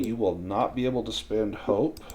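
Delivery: normal talking voice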